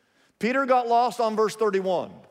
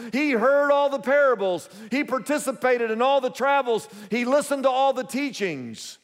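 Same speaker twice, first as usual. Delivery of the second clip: preaching; 5 semitones higher than usual. Acted. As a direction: drive it home like a pulpit crescendo. Preaching build on Peter's closeness.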